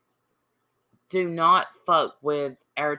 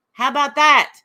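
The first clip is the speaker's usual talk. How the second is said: high, loud triumphant challenge; loud taunt; 8 semitones higher, 9 dB louder than usual. Real, not acted. To a viewer who never made a point, a loud high taunt. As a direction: throw it at them like a mic drop.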